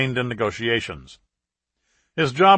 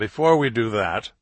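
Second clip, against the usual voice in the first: ordinary delivery, nothing marked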